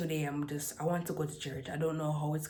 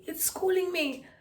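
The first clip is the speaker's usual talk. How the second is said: high, strained, louder put-on squeak; squeaky high voice; 14 semitones higher, 6 dB louder than usual. Vlogger repeating her school complaint in a high squeak. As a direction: say it again in that tiny pinched voice. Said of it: "I kinda like my voice like this".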